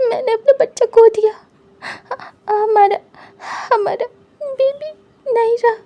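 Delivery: high, loud, tearful wail breaking into sobs; sobbing grief. Acted. Grieving partner sobbing to his husband, high and loud. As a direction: cry out the loss of the baby. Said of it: "wing बुरी तरीके से रोता है।".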